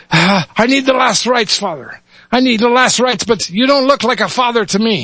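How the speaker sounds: high, weak Irish brogue; Irish lad. Acted. Dying lad asks the rabbi for rites, Irish accent. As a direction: plead then doubt in an Irish voice. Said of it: "switch between dialects here".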